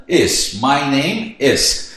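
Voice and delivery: drawn-out, word by word; very slow model answer. Shown as a demonstration. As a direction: spell the answer out slowly for a beginner. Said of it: "como que eu falo?". (resposta arrastada, palavra por palavra)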